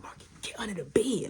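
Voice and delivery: quick hushed order; retold secretive hiding command; urgent whisper. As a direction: whisper fast so mom won't hear. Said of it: "I'm telling him to".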